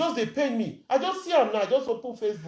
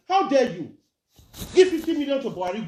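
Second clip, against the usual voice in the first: ordinary delivery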